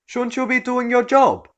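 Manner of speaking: rude entitled woman. Acted. Rude customer voiced in story.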